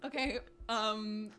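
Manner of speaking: sheepish confession between giggles; embarrassed laughing. Real, not acted. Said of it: "I didn't realize we were gonna share".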